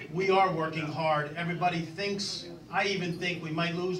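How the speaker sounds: ordinary talk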